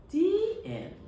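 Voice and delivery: high, bright finishing flourish; cheerful sign-off. Acted. Storyteller ends the Anansi tale for children, cheerful. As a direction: sing out the ending.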